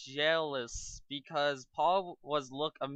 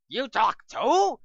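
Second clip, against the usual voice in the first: amazed villain; 8 semitones higher, 11 dB louder, slower than usual. Acted. Mew three amazed.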